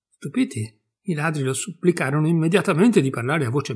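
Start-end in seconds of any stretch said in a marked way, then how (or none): none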